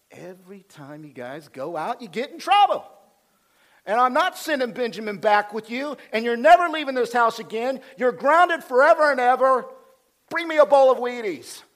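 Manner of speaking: hammed-up scolding dad, high and loud; mock angry father. Father Jacob grounding his sons in a comic rant, mock angry. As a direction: start contained, then burst into high, loud ranting. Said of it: "And Jacob is like".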